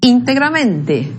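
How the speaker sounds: "Integramente" slow, stressed on IN and MEN; deliberate double accent on "Integramente"; two stresses. Shown as a demonstration. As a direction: say "Integramente" as íntegra plus mente. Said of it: "na fala nós temos dois acentos".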